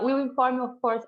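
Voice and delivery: ordinary delivery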